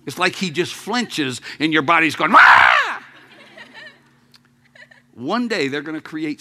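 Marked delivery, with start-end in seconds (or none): 2.3-3.0 low, loud mock yell of a rider thrown about. Preacher voicing a bull rider's body, loud low yell. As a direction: let out a big low yell like on a bucking bull.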